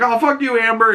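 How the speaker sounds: high-pitched mock indignation; pissed off. Boyfriend jealous of girlfriend's praise, high mock-angry retort. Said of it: "he's all pissed off".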